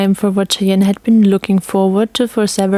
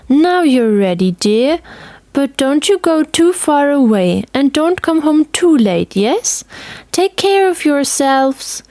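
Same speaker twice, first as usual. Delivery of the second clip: put-on higher, gentle old lady's voice; fussing grandmother; 6 semitones higher than usual. A narrator's reading. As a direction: send the kids off with loving caution. Grandmother fusses.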